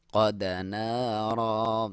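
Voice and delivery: slow قد نارا ending on a long alif; slow demo of the pause; pause form. Shown as a demonstration. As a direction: stop on نارا and stretch the alif. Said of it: "الف مدہ پڑھیں گے".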